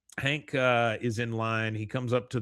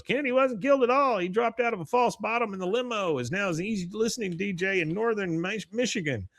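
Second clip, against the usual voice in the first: a much higher, fast put-on voice; second conspiracy guy; 9 semitones higher than usual. Second pamphlet guy pitches his theory to Dale, much higher silly voice. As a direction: rattle off the theory in a high silly voice.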